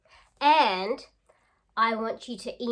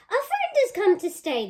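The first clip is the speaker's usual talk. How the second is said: a hint of cheerful wombats, higher; happy wombats; 12 semitones higher, 8 dB louder than usual. Wombats happily welcoming Snowy into their home.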